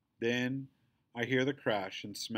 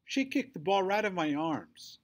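high, raised protest; indignant accusation; 8 semitones higher, 4 dB louder than usual. A narrator's reading. Bully blaming the girl for the kick, indignant. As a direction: tattle on her indignantly.

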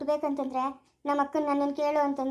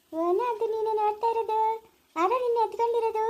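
put-on baby talk; 5 semitones higher than usual. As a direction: coo at the baby in her arms. Cooing at the newborn baby.

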